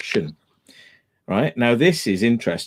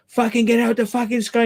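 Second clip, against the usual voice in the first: imitated megaphone shout; 9 semitones higher than usual. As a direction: mimic someone yelling orders, without the volume. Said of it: "shouts got louder and then a megaphone".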